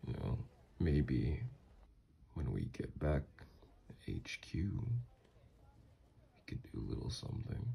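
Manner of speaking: soft, halting flirtation, sinking to a whisper; hint at it privately to a lover; suggestive murmur. Acted.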